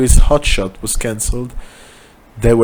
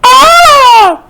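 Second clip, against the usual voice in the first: high loud horrified scream; hammed-up terrified scream; 9 dB louder than usual. A narrator's reading. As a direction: shriek at the severed head. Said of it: "Both started screaming".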